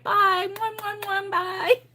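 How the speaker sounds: put-on high child voice smacking kisses; little girl blowing kisses. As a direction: blow kisses in a high toddler voice. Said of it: "and she's like".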